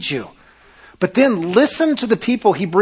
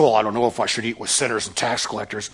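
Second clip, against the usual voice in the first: doubtful churchgoer; 7 semitones lower, 5 dB quieter, faster than usual. Retold or imitated. Imagined hesitant churchgoer objection.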